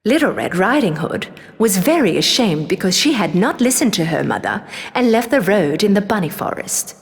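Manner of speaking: ordinary delivery, nothing marked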